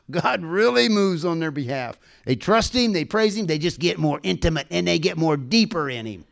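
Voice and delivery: usual speaking voice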